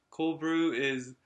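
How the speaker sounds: slow, emphatic imitation of a friend's warning; retold warning. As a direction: stretch every English word in a retold telling-off. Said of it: "って言われて".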